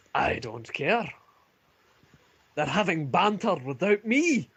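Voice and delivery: building from gruff to outraged; indignant commander. Acted. Ulfric to Joseph, building indignant commander's voice. Ulfric rants about banter.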